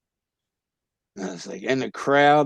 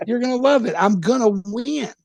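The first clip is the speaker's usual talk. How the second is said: much higher, pushy reassurance; insistent cocky pep talk; 9 semitones higher than usual. Retold or imitated. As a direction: talk them into coming, cocky. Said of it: "And I said".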